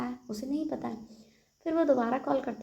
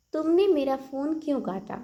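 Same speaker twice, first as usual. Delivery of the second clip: annoyed demand; 5 semitones higher, 5 dB louder than usual. Shown as a demonstration. Angry friend, phone cut.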